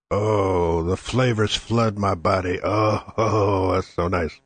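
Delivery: low, indulgent pleasure after the shot; savoring the drink. Real, not acted. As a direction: melt into the taste of the tequila.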